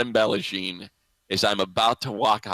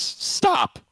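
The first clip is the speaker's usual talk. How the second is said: laughing mock nurse.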